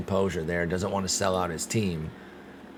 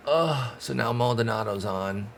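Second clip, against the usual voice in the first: ordinary talk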